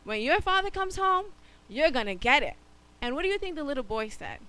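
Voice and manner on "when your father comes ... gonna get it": louder scolding threat; a hint of a stern auntie. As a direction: warn him he's in for it. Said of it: "his auntie was like".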